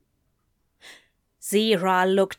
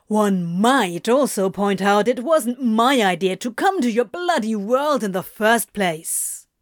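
mock-formal, needling retort; 3 dB louder than usual. A narrator's reading. Crowley blames Anthony for bringing him here, mocking. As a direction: needle them with a mocking counterpoint. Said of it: "Crowley mocked".

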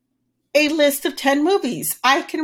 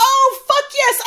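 thrilled; 11 semitones higher, slower than usual. Real, not acted. Thrilled at movie pick.

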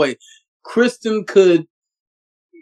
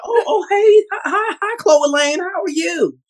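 high-pitched, over-sweet greeting; flustered fake-friendly hello, pitched way up; put-on niceness; 12 semitones higher than usual. Actress imitating herself switching to greet Chloe, in put-on niceness. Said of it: "trying to be nice to her".

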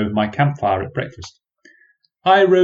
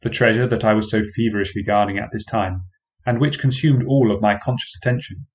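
ordinary delivery

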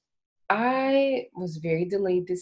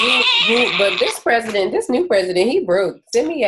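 excited loud talk; 6 semitones higher, 9 dB louder than usual. Real, not acted. Heated excited stimulus talk.

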